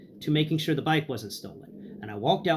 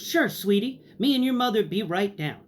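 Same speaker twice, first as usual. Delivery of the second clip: high, tender grandma; imitating a kindly grandmother; sweet grandma voice; 6 semitones higher, 3 dB louder than usual. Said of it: "And she goes".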